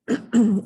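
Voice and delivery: sharp throat clear. Real, not acted. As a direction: clear your throat loudly. Clears throat between lines.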